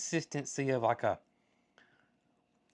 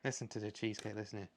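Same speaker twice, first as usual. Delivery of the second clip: hushed; 3 semitones lower, 9 dB quieter, faster than usual. Real, not acted. Quietly listens to crunchy cheesecake.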